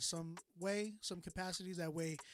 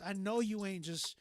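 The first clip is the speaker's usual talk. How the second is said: ordinary talk, nothing marked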